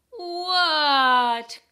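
high, loud drawn-out shout back; yelling. A narrator's reading. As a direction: frog yelling back from the snow. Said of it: "yelled Froggy".

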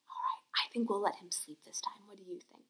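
fast hushed whisper. Pretend. Teacher whispers to children beside sleeping bear. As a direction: whisper quickly so the bear stays asleep.

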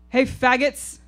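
high, loud jeering yell across a parking lot; hostile scream. Acted. Drunk man screams at the women across the lot, loud and high. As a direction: bellow the slur like a drunk bully. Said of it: "he screams".

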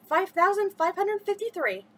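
final number rung out high and loud; the record jump, called out proudly; triumphant count. A narrator's reading. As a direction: announce the winning jump in triumph. Winning count.

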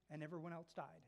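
ordinary talk

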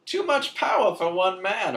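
high grand solemn hero voice; noble hero. Retold or imitated. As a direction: play it like a noble sacrifice. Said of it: "throws it off a bridge".

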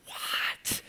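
hushed unvoiced what, floored; shocked whisper. Friend hearing he has two kids, stunned whisper. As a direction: whisper it in total disbelief. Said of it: "He was like".

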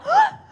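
high, loud yelp of pain, half laughed; laughing cry of pain. Acted. The stung villager yelps, half laughing.

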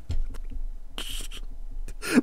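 breathless silent wheeze of laughter; silent wheezing laugh. Real, not acted. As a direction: laugh with no voice left. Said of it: "I'm laughing".

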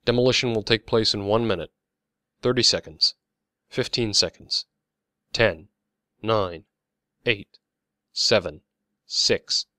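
measured announcer with spaced-out countdown; loudspeaker announcement. A narrator's reading. Loudspeaker warning the crowd before demolition, announcer-style countdown. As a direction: announce it firmly, pausing between counts. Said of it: "An announcement was made by a loudspeaker".

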